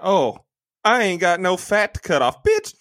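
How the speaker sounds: high-pitched mocking imitation of a woman; mock surprise. Podcaster mocking a movie character's act, high mock surprise. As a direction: imitate her, shooting up to a squeal at the end. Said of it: "acted all surprised".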